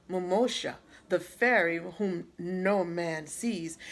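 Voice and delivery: usual speaking voice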